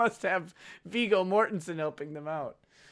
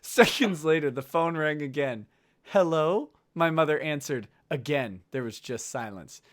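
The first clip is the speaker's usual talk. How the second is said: low, measured audiobook read; deliberate, solemn narrator; story narration; 6 semitones lower than usual. A narrator's reading. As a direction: read it out gravely and evenly. Reads book aloud.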